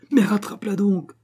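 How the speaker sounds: high, loud angry command. A narrator's reading. Wife to husband chasing the frog, angry. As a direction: order it out furiously, pitched right up. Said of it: "fit avec colère la femme".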